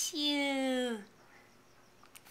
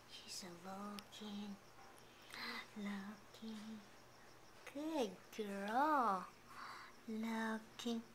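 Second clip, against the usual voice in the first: hushed, much softer and lower cooing; 6 semitones lower, 8 dB quieter than usual. Real, not acted. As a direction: coo gently so you don't startle her. Soft cooing at the baby.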